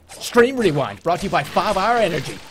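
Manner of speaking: loud mock sponsor announcement; announcer voice. Streamer introducing a sponsored segment, mock announcer voice. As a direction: boom out the segment title like an announcer.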